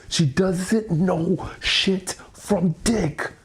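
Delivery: very slow, each word spelled out; slow, deliberate scorn; slow emphatic. Real, not acted. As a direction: drag every word to drive it home. Venting about girlfriend.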